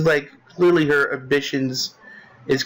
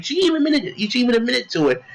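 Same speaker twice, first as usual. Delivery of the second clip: high, fast indignant repetition; insist, voice pitched high; incredulous emphasis on even; outraged; 9 semitones higher, faster than usual. Real, not acted. Outraged repeat of her admission.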